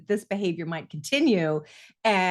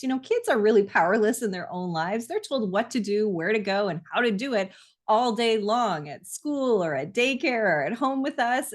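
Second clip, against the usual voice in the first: ordinary talk, nothing marked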